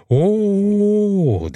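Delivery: long, high drawn-out "ooh"; awed crowd exclamation. A narrator's reading. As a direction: gasp in wonder as if watching fireworks. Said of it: "hep birden havai fişek gösterisi seyrediyormuşçasına".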